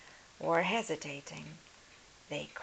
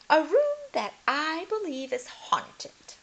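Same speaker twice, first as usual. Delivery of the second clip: high, loud, emphatic governess; emphatic Miss Clavel; 12 semitones higher, 10 dB louder than usual. Acted. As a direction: insist firmly to the lord. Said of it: "her voice emphatic".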